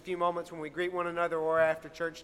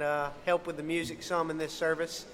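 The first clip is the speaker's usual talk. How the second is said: ordinary talk, nothing marked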